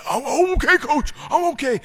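put-on very high, loud kid's voice; tough kid insisting. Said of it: "we ask them".

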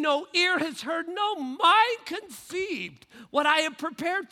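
loud, high-energy sermon delivery with punched key words; impassioned preaching. Real, not acted. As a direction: preach it with rising fervour. Preaching at full force.

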